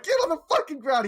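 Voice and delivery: high, fast, loud order; imitated police shout. Police bursting in on suspect, loud mock shouted order. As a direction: shout a rushed mock command.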